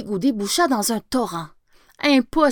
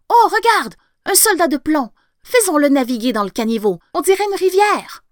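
put-on excited child; 5 semitones higher, 5 dB louder than usual. A narrator's reading. Child finds the soldier.

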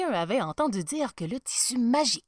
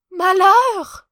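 loud, high cry of dismay; horrified; 11 semitones higher, 16 dB louder than usual. A narrator's reading. Emperor finding he sees nothing, horrified. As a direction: cry out, seized with horror. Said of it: "saisi d'horreur".

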